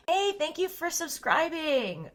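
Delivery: drawn-out cheerful hello; bright thank-you. Real, not acted. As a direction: sing out the thanks slowly. Thanking new subscriber on stream.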